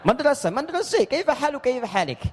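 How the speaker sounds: high mock "madrasah" drifting to "madrose"; singsong Levantine imitation with "kaifa halik"; playful Syrian accent; Levantine Arabic accent. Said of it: "banyak itu yang E".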